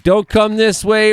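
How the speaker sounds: high, slow mock warning; mock warning call. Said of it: "he's probably saying".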